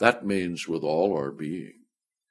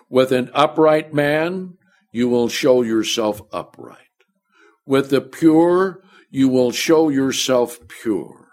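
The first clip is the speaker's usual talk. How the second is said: loud, high reading of the verse; preaching scripture; 4 semitones higher, 5 dB louder than usual. Acted. Preacher proclaiming the verse to the church, loud and high. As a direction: declaim each line to the church.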